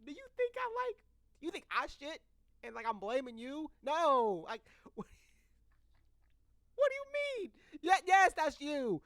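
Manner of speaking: baffled and half-laughing; exasperated disbelief. Retold or imitated. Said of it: "And I'm like".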